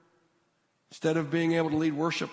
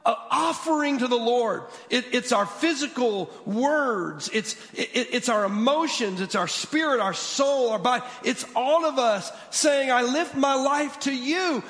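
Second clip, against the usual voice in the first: full-force preaching; 5 semitones higher than usual. Acted. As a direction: preach it out with fervor.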